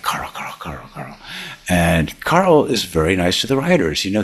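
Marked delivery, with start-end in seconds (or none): 0.0-1.2 breathy fawning whisper of his name. Retold or imitated. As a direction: whisper it like eager writers sucking up. Writers fawning over Carl.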